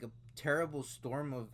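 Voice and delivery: ordinary talk, nothing marked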